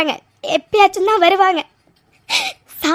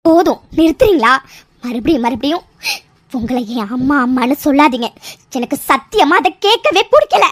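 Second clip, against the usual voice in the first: much lower, heavy bitter voice; bitter anger; 9 semitones lower than usual. Acted. Mithra refuses to call her mother, low bitter anger. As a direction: reject her coldly and low. Mithra rejects being called daughter.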